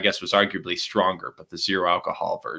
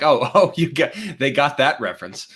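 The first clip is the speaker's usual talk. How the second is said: laughing; 6 semitones higher than usual. Real, not acted. Laughing at kids getting reference.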